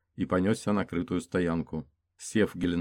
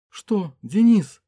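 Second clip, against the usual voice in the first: much higher, slow and stunned; high shocked female voice; 13 semitones higher, 11 dB louder, much slower than usual. Acted. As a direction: gasp out his name in shock. Shocked cry over wounded man.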